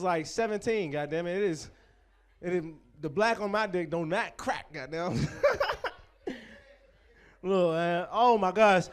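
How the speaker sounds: ordinary talk, nothing marked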